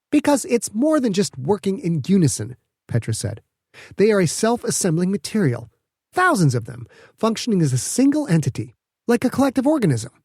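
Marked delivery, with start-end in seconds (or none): 0.1-2.6 put-on young inventor, eager and higher; bright young scientist explaining proudly; Petra's voice. Petra explains her minids. 4.0-10.1 put-on young inventor, eager and higher; bright young scientist explaining proudly; Petra's voice. Petra explains her minids.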